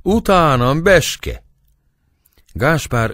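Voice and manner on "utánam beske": much higher, louder call; calling back. A narrator's reading. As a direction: call back over the shoulder. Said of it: "hátraszólt az asszonyának".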